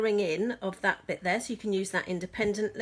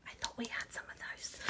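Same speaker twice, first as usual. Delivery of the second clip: whispered aside; 14 dB quieter than usual. Real, not acted. Muttered aside.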